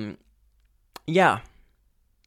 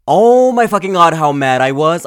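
loud, high angry exclamation; shouting how mad she was; furious outburst; 5 semitones higher, 9 dB louder than usual. Real, not acted. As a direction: burst out with real fury. Recalling rage at theater.

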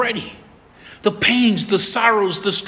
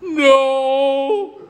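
high, drawn-out mock whine; tearful child; 7 semitones higher than usual. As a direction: wail it like an upset little girl. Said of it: "And tears".

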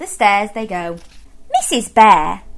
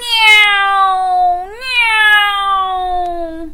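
aeroplane noise; 10 semitones higher than usual. Acted. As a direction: play aeroplane like a child. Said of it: "went Baby Bear".

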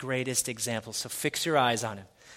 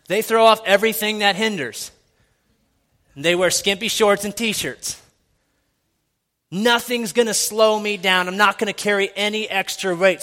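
loud, high sermon push; preaching at full force; 8 semitones higher, 10 dB louder than usual. Acted. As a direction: drive it home to the congregation. Preaching about runners throwing off.

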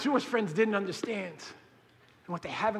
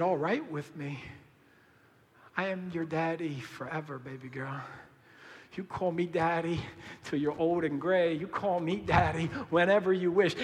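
softer, hushed promise to a daughter; tender father; 3 semitones lower, 6 dB quieter than usual. Retold or imitated. Father softly tells his daughter he is hers, tender. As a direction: speak it gently like a doting dad. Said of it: "And I said".

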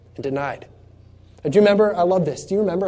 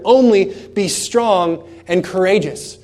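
loud, raised and slow on the commands; commanding preached voice; 4 semitones higher, 6 dB louder, slower than usual. Acted. God commanding fearful Joshua, loud and slow. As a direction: thunder God's command to be strong.

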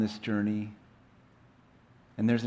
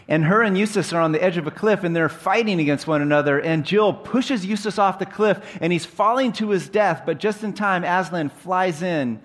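higher, louder, racing action narration; urgent storytelling; 7 semitones higher, 6 dB louder than usual. A narrator's reading. Telling the congregation of the fight on the cliff, urgent and excited. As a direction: build the danger breathlessly fast and loud.

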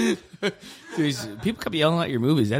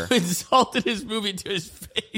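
slow, higher, laughing; laughing tease; 8 semitones higher, 4 dB quieter, slower than usual. Real, not acted. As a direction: tease your friend while cracking up. Teasing friend, laughing.

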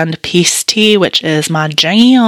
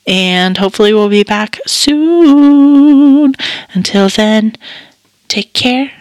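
podcast sign-off; 6 semitones higher, 4 dB louder than usual. Real, not acted.

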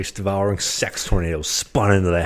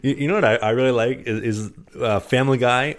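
ordinary delivery

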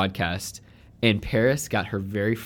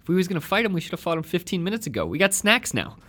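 higher, lightly voiced Casey Affleck line; Casey Affleck impression; 8 semitones higher, 4 dB quieter than usual. Quoting his favourite Casey Affleck movie line, higher. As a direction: toss off the Casey Affleck quote a bit higher. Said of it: "actually Casey Affleck".

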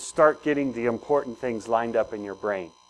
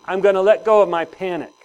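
louder and higher, firmly declared; with conviction; 4 semitones higher, 6 dB louder than usual. Shown as a demonstration. Pastor leads the congregation in a firm declaration. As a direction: say it like you mean it, for all to repeat. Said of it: "I hope you said that with conviction".

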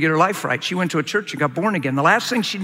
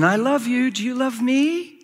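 high, drawn-out question with rising voice; fishing for love in return; needy pleading; 7 semitones higher than usual. Acted. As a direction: ask it needily, climbing higher to the end.

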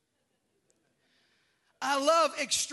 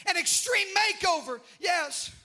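calling out to the congregation; preaching; 4 semitones higher than usual. Acted. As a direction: hammer it home. Preaching climax.

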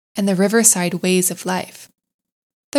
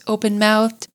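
ordinary delivery

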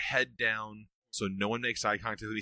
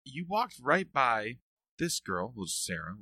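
quoting his wife; 7 semitones higher, 3 dB louder than usual. Retold or imitated. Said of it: "And she said".

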